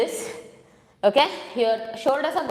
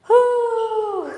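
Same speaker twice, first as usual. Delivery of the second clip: sharp high breathy "whew" after effort; exertion exhale; 9 semitones higher, 5 dB louder than usual. Real, not acted. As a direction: blow out hard, winded from the workout. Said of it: "I'm breathing hard".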